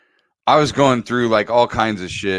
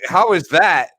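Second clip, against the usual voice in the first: mock outraged, higher coach voice; pretend coach scolding; 5 semitones higher than usual. The imagined coach chewing him out, mock outraged.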